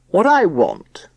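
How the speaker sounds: slow, loud, grand pronouncement; pompous father voice. Acted. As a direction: lay down the rules pompously. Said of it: "solemn, pompous voice".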